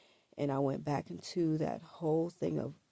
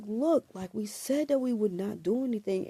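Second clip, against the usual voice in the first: higher and louder, reminding him of the deal; retold yelling; 6 semitones higher, 5 dB louder than usual. Reminding him they agreed to nothing, a raised urgent voice. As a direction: raise your voice to remind him of his promise. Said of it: "the whole time I'm yelling at him".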